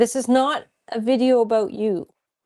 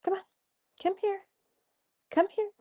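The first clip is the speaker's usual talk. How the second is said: coaxing the dog; 9 semitones higher, 8 dB quieter, faster than usual. Real, not acted. Calling the dog over.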